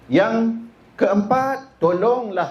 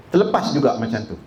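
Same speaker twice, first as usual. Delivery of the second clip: ordinary delivery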